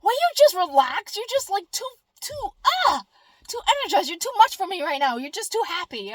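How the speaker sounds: high, loud, put-on voice of an annoyed friend; mock whiny plea to calm down; exasperated coworker. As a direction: imitate someone fed up with your cheer. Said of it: "And they're like".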